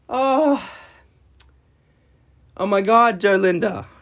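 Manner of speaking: high, loud wailing complaint; real anguished outburst, voice pitched far up; distraught yelling. Real, not acted. Streamer wailing at Twitch for deleting his tribute, high loud anguish.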